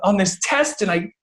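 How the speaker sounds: put-on a high, distraught kid voice, close to tears; upset daughter near tears. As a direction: imitate a girl about to cry over her test. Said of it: "almost in the break of tears".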